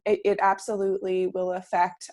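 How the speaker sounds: usual speaking voice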